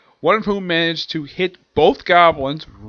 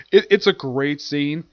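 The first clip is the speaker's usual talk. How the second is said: normal talking voice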